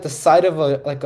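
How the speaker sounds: ordinary talk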